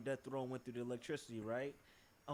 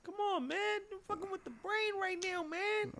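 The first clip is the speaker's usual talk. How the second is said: squeaky, much higher voice; high-pitched exasperation. Real, not acted. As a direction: whine it way up high. Mock outrage at friend's correction.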